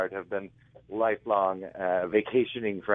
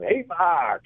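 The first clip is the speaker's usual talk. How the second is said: teasing call; 8 semitones higher, 6 dB louder than usual. Retold or imitated. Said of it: "and they go".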